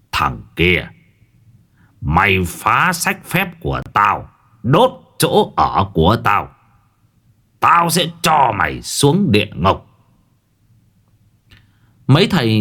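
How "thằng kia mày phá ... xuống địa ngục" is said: spiteful threat. Acted. Sorcerer Mông Sen threatening the young master. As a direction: threaten with cold hatred. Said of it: "ánh mắt hằn học".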